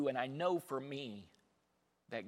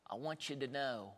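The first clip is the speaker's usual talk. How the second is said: ordinary talk, nothing marked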